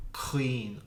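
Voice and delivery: "Clean" from the back of the throat, no w glide; clean, no w sound. Shown as a demonstration. Teacher demonstrating "Clean" to learners, throaty k, no w. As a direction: say "Clean" with a throaty broad k, lips not rounded into w. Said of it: "I'm not saying a W sound at all".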